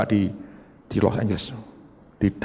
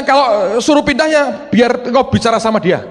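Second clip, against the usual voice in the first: high, loud exasperated appeal; loud plea to God; 13 semitones higher, 7 dB louder than usual. Retold or imitated. Preacher telling God to talk to his wife, loud and exasperated. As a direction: throw the problem back to God, loudly.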